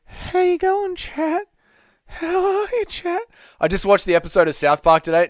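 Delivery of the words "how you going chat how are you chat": very high falsetto elder voice; extremely high-pitched character impression; elder dude. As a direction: greet them in a tiny, put-on old-timer falsetto. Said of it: "Not the elder dude impression".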